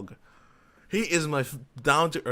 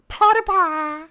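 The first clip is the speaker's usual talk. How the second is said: much higher, louder, squeaky mock name; silly mocking voice; 9 dB louder, slower than usual.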